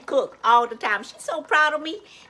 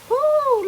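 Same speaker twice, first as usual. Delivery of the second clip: high excited woo; 14 semitones higher, 6 dB louder than usual. Real, not acted. Excited exclamation at cooking pot.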